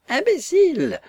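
squealing high-pitched screech through the window; yelping. Acted. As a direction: yap the insult like a furious little dwarf. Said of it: "Poufka glapir à travers la fenêtre".

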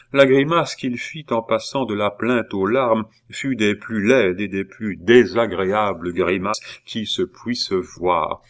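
ordinary delivery, nothing marked

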